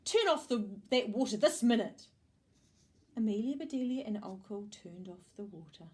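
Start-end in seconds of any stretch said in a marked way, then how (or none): 0.0-2.1 loud, higher-pitched scolding command; shouted order. A narrator's reading. Missus Rogers orders the water off, shouting. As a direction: order it at once, loudly. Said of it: "shouted Missus Rogers".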